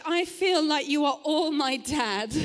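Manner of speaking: usual speaking voice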